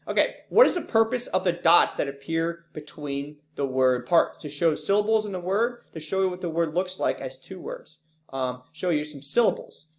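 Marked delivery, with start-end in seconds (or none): none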